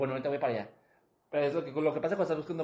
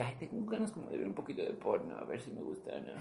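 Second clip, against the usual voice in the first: curious; 4 semitones lower, 9 dB quieter than usual. Retold or imitated. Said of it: "de curiosito de que".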